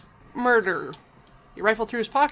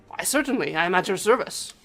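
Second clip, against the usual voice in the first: put-on obliging French-accented detective; French gentleman. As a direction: offer your service in a courtly French accent. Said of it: "a portly man with a dapper gray suit".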